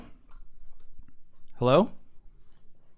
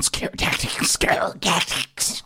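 slow, breathy whisper of "Scare tactics"; creepy troll whisper; 2 semitones higher, much slower than usual. Retold or imitated. Caller mimicking the clerk as a troll, slow creepy whisper. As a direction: drag it out like a lurking troll. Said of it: "Now you sound like some kind of troll".

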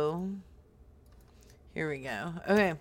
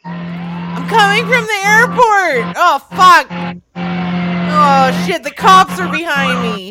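panicked, higher and louder; frantic yelling; 3 semitones higher, 8 dB louder than usual. Acted. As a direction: yell it in a driving panic. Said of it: "so it sounds like you're frantic".

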